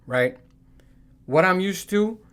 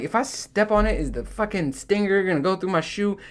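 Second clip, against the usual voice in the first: worried; 6 semitones higher than usual. Retold or imitated. Worried about killing scorpion.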